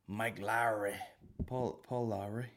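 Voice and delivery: slow, thick Northern Irish accent; deliberately shoddy Northern Irish pronunciation. Shown as a demonstration. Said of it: "say that in a Northern Irish shitty accent".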